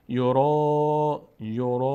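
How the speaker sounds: "یورا" with the final aa held long and level; measured madd. Shown as a demonstration. Teacher demonstrates madd length for learners, drawn-out vowel. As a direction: stretch "یورا" for a count of three fingers.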